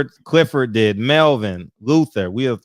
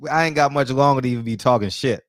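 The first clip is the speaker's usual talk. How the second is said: ordinary talk, nothing marked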